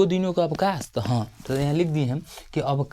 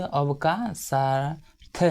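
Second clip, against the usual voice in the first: slow dictation; much slower than usual. Shown as a demonstration. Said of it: "तो कहते हैं".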